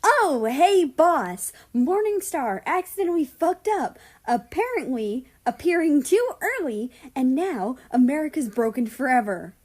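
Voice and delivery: high, loud, bitter mockery of her own confession; mocking herself in a bitter pretend call to the boss; sarcastic mock report. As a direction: play it as a scornful fake announcement.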